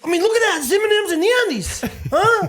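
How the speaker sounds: very high, half-laughing rapper impersonation. Acted. Player defending the candy answer, joking impersonation. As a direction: pitch it way up, laughing through it. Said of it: "Are you trying to be [name]".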